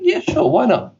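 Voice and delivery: high-pitched, carefree retold yes; breezy agreement. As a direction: quote yourself agreeing lightly, pitched well up. Quoting own casual agreement to job.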